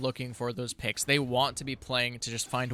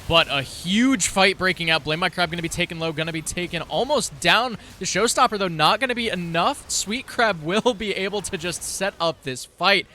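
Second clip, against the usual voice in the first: play-by-play; 4 semitones higher, 5 dB louder than usual. Acted. Live play-by-play of a fight.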